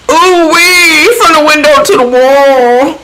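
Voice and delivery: mock her catchphrases in a high voice; Carmen. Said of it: "This is how she talks".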